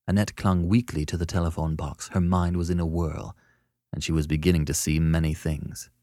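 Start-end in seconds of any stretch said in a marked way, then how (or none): none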